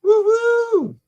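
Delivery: high falsetto woo-hoo; whooping cheer. Acted. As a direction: let out a loud party whoop. Cheering outburst.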